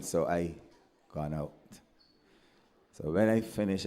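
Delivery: ordinary talk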